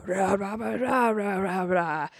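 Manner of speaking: gibberish in a foreign-sounding voice; mock Elvish. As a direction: babble like an elf lord. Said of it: "like, speaking Elvish".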